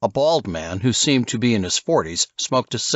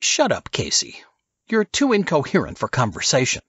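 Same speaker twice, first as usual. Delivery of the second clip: higher, dismissive Silas; contemptuous order; curt rebuke; 6 semitones higher than usual. Acted. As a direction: brush off the drunk surfer.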